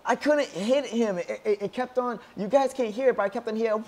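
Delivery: high-pitched, whiny complaint to viewers; upset protest. Real, not acted. Protesting after losing.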